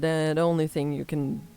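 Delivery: ordinary delivery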